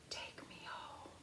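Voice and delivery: breathy whisper of a magic marble. A narrator's reading. The marble whispering to Penny from the lawn. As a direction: hush it like a secret call. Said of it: "The marble seemed to say".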